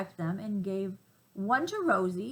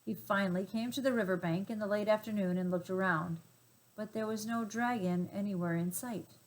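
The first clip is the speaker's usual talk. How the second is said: plain reading voice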